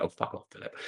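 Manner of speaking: whispered, embarrassed self-deprecating brush-off; British modesty. Retold or imitated. To [name] praising his melodies, bashful whispered brush-off. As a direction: mutter it bashfully under your breath. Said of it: "Totally British".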